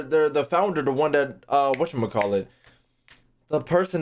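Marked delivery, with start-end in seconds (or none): none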